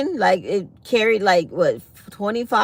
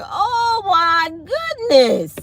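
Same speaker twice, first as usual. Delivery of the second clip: shocked exclamation; 9 semitones higher, slower than usual. Retold or imitated. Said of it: "I was like".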